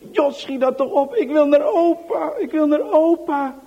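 high, loud whining cry; whimpering plea. Acted. Johan whimpering he wants his grandpa, high wail. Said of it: "kermt de jongen".

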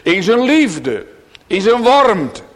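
loud, slow, rising sermon voice; fervent pulpit proclamation; preaching at full force. Acted. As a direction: proclaim it to the congregation with weight.

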